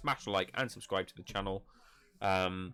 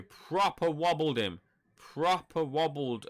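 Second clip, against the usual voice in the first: excited; 6 semitones higher, 3 dB louder, much slower than usual. Real, not acted. Excited boxing commentary.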